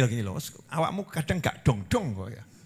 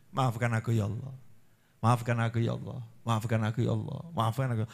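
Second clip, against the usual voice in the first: humble plea; 6 semitones lower, 6 dB quieter, faster than usual. Pretend. Repeated humble plea to God.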